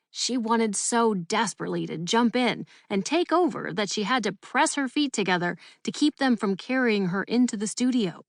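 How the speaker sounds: usual speaking voice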